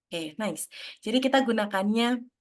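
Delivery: ordinary delivery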